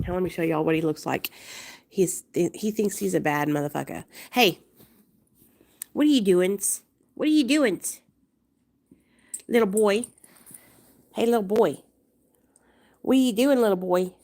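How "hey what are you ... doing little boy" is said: louder, calling out the dog; loud teasing challenge. Real, not acted. Owner calling out her dog in a standoff, loud and teasing. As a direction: challenge a tiny tough guy.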